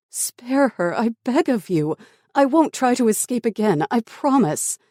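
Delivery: high, loud pleading; desperate begging. Acted. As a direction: beg frantically for her life. Captive begs for girl's life.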